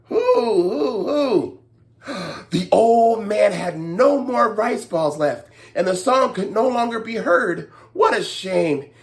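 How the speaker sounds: ordinary delivery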